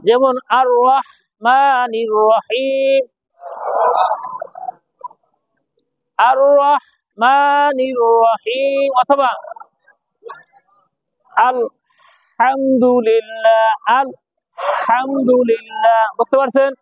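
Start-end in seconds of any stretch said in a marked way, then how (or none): 0.6-8.9 recited very slowly with a throat-squeezed ha; throaty long ha. Shown as a demonstration. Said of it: "গলায় শস্য শব্দ হবে". 11.5-16.0 very slow recitation, ha squeezed from mid-throat; throaty long ha. Shown as a demonstration. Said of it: "গলার মাঝখান দিয়ে কি চেপে আসবে".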